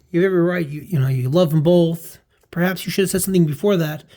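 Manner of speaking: emphatic reply. Retold or imitated. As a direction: lean into it.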